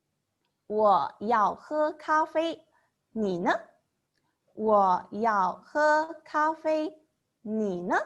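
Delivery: "我要喝咖啡" drawn out slowly; slow teacher's model of a question; slow model sentence. Shown as a demonstration. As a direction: say it slowly for beginners. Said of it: "For example".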